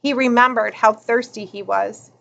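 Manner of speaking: ordinary delivery, nothing marked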